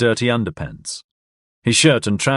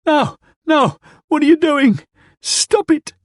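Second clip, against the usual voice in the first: much higher, louder terrified pleas; panicked shrieking; 4 dB louder than usual. Acted. Drunk dragged across the floor, panicked shrieks. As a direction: scream in panic.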